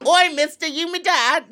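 high, loud British squawk; British meme-doll accent. Acted. Host derailing the guest intro, loud British meme accent. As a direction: burst out in squeaky British. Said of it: "that's a meme of a, a doll".

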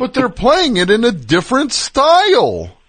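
high, loud, hammed-up disbelief; mock astonishment. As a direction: exclaim as if your mind is blown. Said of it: "amazing that there was a point where people were like".

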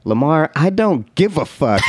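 mock dismissive girl. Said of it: "And she's like".

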